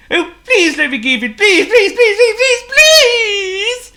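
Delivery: rising high loud pleading; begging. Acted. Potto begs Hippo, escalating high pleading cries. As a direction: beg harder with every please.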